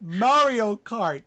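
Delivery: loud, high, stretched-out amazed cry; excited exclamation. Real, not acted. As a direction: shout it out in delighted disbelief. Amazed repeat of kid's answer.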